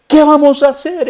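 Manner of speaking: high, loud panicked cry of the servant; acting out the servant's alarm; frightened servant. Servant cries out to the prophet, high, loud and frightened. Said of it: "Está asustado".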